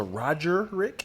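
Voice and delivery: "Roger Rick" said as two separate names; two-word mispronunciation. Shown as a demonstration. Checking the other's mistake, "Roger Rick" as two names. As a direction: say "Roger Rick" with a soft G, questioning. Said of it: "Also, there's no G".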